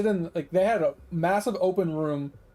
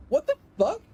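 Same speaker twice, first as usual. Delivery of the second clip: indignant what the fuck, pitched way up; outraged exclamation; 11 semitones higher than usual. Real, not acted. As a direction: let the outrage spike on it. Outraged at lost mouse.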